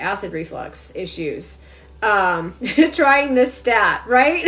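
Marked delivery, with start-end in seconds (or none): 2.0-4.5 real laughter breaking through the words; laughing. Real, not acted. To the live viewers, laughing at her own advice. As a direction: laugh your way through it, slower.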